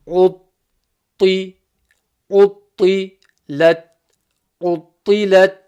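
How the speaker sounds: loud, heavy emphatic ta in utti. Shown as a demonstration. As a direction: push the ta in utti with plenty of emphasis. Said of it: "Notice the emphasis on the ta".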